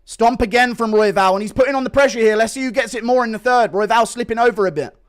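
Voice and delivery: excited fight commentary; live play-by-play. Real, not acted. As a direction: call the action live.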